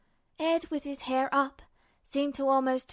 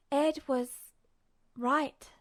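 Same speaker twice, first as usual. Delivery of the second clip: ordinary delivery, nothing marked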